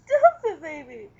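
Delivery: loud, high ticklish squeal; being tickled. Real, not acted.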